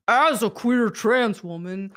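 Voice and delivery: mock male voice. Shown as a demonstration. Reading the developer's quote as the writer himself, in a mock male voice. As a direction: read it the way the writer would say it. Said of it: "let me read it like him".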